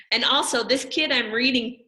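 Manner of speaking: plain reading voice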